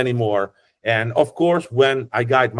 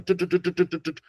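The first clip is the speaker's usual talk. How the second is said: higher, rapid tu tu machine gun sound; machine gun noise; 7 semitones higher, 5 dB quieter, faster than usual. Retold or imitated. Guide imitating a machine gun to describe Sardinian. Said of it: "like a machine gun".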